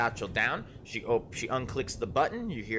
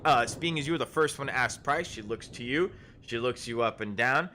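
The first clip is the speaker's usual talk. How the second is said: ordinary delivery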